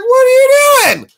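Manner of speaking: high falsetto screech easing into aggrieved complaint; imitated indignant cat. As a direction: play an outraged pet, shrieking then settling. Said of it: "she wasn't very happy".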